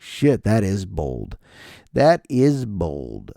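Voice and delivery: slow, drawn-out amazement; deadpan awe. Acted. Comic reacting to potty carved in wall, slowly awed. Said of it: "and I was like".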